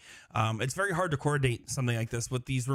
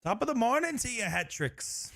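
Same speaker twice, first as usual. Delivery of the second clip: a hint of a hammed-up Irish greeting; mock Irish; 9 semitones higher than usual. Co-commentator trying a mock Irish greeting. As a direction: toss off a cheesy Irish hello, higher. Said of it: "Not quite".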